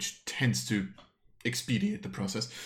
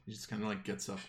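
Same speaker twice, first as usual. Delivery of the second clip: normal talking voice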